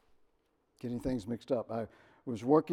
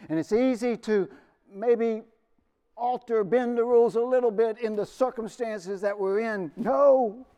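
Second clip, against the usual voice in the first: louder, higher, urgent sermon delivery; fervent preaching; 6 semitones higher, 9 dB louder than usual. Real, not acted. Pastor urging his congregation, in full preaching force. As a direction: press the point home from the pulpit.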